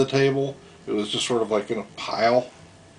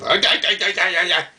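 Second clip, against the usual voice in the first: mock foreign shouting; 7 semitones higher, faster than usual. Waiter shouting in the parking lot, high mock yelling. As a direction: imitate someone yelling words he can't follow. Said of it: "somebody shouting".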